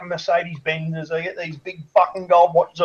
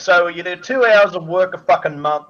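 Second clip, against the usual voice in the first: normal talking voice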